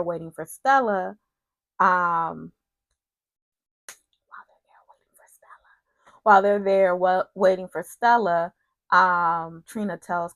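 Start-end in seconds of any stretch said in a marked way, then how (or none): 4.3-6.2 very soft, voiceless whisper. Real, not acted. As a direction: whisper it, barely audible. Repeats the phrase in a whisper.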